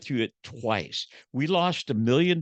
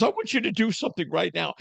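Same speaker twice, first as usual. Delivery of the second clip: quick, higher insistent wife imitation; wife's demand; 8 semitones higher, faster than usual. Wife ordering husband to act now, quick high insistence. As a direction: push it out fast like a fed-up wife. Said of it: "She goes".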